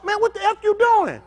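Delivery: high, outraged bystander yell; angry bystander. Retold or imitated. Preacher voices crowd yelling at police, high and outraged. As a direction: call out in disbelief at the police. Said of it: "The folks tell".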